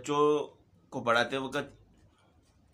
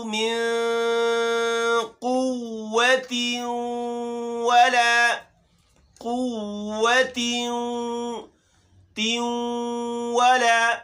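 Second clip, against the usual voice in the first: tajweed recitation; 4 semitones higher, slower than usual. Shown as a demonstration. Teacher recites the verse for the children, slow chant. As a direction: draw each word out.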